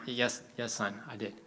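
lightly voiced patient dad; reassuring dad. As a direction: quietly settle a fretting son. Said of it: "he's like".

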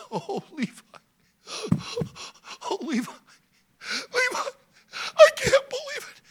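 high, laughing joyful shout; overjoyed cry. Acted. Father Jacob greeting son Levi with a joyful shout. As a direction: burst out laughing with joy.

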